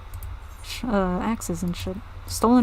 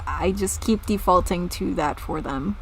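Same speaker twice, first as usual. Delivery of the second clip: ordinary delivery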